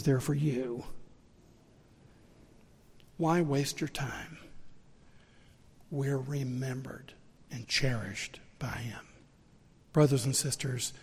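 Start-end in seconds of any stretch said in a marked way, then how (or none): 5.9-9.0 slow, soft near-whisper; tender hushed reassurance. Real, not acted. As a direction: drop to a whisper of comfort. Tender aside after outburst.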